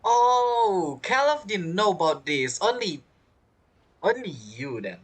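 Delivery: ordinary delivery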